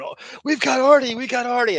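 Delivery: high, loud excited caller imitation; Monique. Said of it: "getting this call from Monique".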